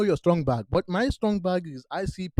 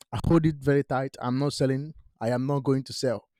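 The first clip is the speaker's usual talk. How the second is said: normal talking voice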